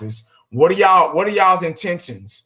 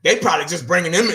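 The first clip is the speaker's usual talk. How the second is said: ordinary delivery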